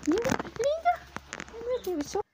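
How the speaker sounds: high gushing praise; baby talk to dog. Acted. Woman praising her dog, high cooing baby talk. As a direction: gush over the puppy.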